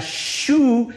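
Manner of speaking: "Shu" with a long hissed SH; sounding out SH. Shown as a demonstration. Preacher isolating a sound absent in Greek, drawn-out "Shu". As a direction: draw out the SH at the start of "Shu". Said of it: "ese sonido no existía".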